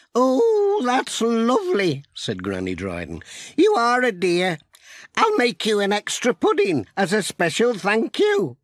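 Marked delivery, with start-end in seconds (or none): 0.1-2.0 put-on very high, thrilled old lady's "Oh"; delighted granny. A narrator's reading. Granny delighted, voiced in story. 3.6-8.6 put-on much higher, sweet old lady voice; kindly granny. A narrator's reading. Narrator voices Granny Dryden.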